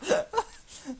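high, loud burst of laughter; squealing laugh; embarrassed laugh. Real, not acted. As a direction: crack up at own mistake. Mistook scarf for limbs.

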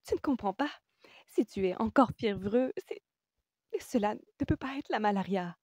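high, urgent, breathless realization; excited. A narrator's reading. Woman explaining to patient it cannot be malaria, excited and high. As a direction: press the discovery on him excitedly. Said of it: "reprit-elle tout excitée".

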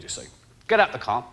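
normal talking voice

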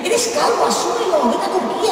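high, teasing "asúmelo"; mocking advice. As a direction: tell them off with a laugh. Mocking balding guys.

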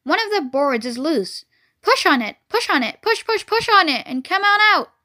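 push, push, push, rising in excitement; urging goose. Acted. Goose coaxing Wilbur through the fence, building excitement.

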